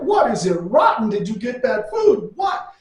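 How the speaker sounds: mock bafflement. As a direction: imitate a friend urgently puzzled. Friend baffled by the wailing woman.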